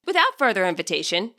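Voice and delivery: ordinary delivery, nothing marked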